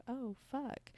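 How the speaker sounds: high-pitched, hushed mock discovery; acted pleasant surprise. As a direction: play caught off guard by arousal. Acting out being surprised turned on.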